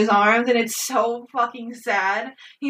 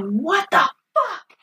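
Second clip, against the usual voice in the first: high, slow, stunned mock yell; mock scream; 10 semitones higher, much slower than usual. Friend retelling a movie betrayal, mock yell of disbelief. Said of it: "I was screaming at this point".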